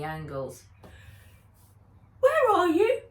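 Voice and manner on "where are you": put-on much higher, louder sleepy boy's voice; yawning. A narrator's reading. Henry just woken, asking Nat through a yawn, in a high sleepy child's voice. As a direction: ask it mid-yawn like a sleepy kid. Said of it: "he yawned".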